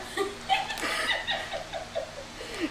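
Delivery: high breathless laugh; real laugh at the funny story. Real, not acted. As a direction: crack up laughing.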